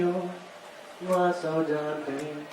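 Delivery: soft echo. Acted. Comedian echoes his own chorus, softly. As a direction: sing it back quietly.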